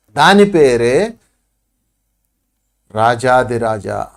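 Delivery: low, slow, drawn-out proclamation; slow grand title. Shown as a demonstration. Guru naming his teaching to the listeners, slow and grand. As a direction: announce the title slowly and grandly.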